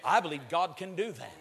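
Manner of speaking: usual speaking voice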